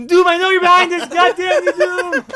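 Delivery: high, shouted mock rage; hammed-up paranoid yelling at an arch-enemy; Reed. Reed rages at Doom, acted out.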